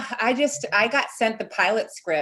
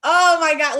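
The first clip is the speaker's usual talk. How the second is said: delighted; 7 semitones higher, 7 dB louder than usual. Retold or imitated. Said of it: "I was like".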